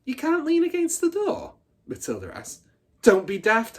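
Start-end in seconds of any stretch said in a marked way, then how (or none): 0.1-1.6 put-on much higher, louder small child; little girl's question. Matilda asking the big girl, high and childlike. 3.0-3.8 much louder, higher scoff; scornful bully. Acted. Hortensia mocking Matilda, much louder.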